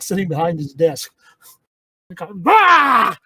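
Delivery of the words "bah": sudden high, loud "bah!"; imitated monster-prank shout; mock startling roar. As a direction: burst out with a jump-scare yell. Retold scare prank on salesman.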